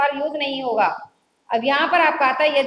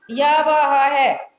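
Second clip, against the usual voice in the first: slowly spelled out; much slower than usual. Shown as a demonstration. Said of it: "slow spelling out".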